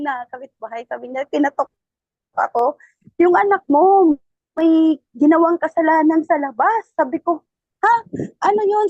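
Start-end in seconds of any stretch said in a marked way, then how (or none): 3.2-6.9 urgent neighbor's call. Retold or imitated. Neighbor bursts in to warn the mother, loud and urgent. 7.8-8.9 loud, higher startled question. Retold or imitated. Mother reacts to news about her son, loud and startled. Shocked reply to the neighbor.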